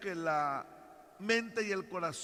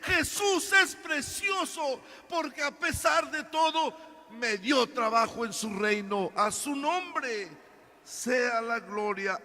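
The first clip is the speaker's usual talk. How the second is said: higher, louder preaching, slowing at the end; preacher voicing Peter's praise; fervent praise; 5 semitones higher, 4 dB louder than usual. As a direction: proclaim it with fervor. Preacher exalting.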